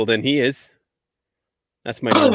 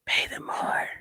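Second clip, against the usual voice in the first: soft whispered line; whispering; 11 dB quieter than usual. Pretend. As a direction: breathe it out. Whisper bit.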